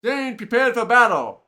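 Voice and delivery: story narration. A narrator's reading. Gamer reads the quest story aloud to viewers, lively narration.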